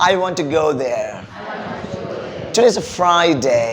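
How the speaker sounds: slow, with "there" and "Friday" falling at the end; statement intonation. Shown as a demonstration. Teacher models statements for the class, slow falling tune. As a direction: stretch Friday and let it settle down. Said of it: "സെന്റൻസിന് sentence ആയിട്ടും".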